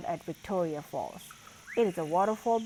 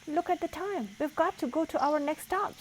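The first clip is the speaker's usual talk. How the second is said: hurrying Grumpy Toad; 10 semitones higher than usual. A narrator's reading. Grumpy Toad urges band.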